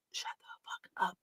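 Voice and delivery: hushed, voiceless whisper; acted-out hissed whisper; urgent whisper. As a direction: whisper it like a dad silencing robbers. Dad hushing bandits in danger.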